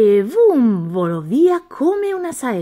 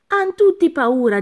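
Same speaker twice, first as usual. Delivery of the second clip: higher, louder, puzzled; a hint of a baffled monster; bewildered monster; 8 semitones higher, 4 dB louder than usual. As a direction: sound thrown by it. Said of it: "disse il mostro interdetto".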